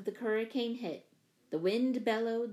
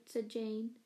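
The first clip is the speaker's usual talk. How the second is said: ordinary delivery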